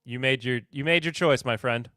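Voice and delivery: ordinary delivery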